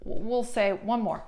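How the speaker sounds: ordinary talk, nothing marked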